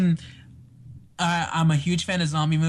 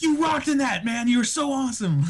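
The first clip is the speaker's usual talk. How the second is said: higher, eager praise; gushing fan; 10 semitones higher than usual. Retold or imitated. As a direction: gush like a starstruck fan. Gushing to the actor.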